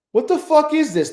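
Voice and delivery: sharp high burst of disbelief; shocked. Real, not acted. Trader yelling at a sudden spike. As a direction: blurt it out, caught off guard. Sudden price jump on chart.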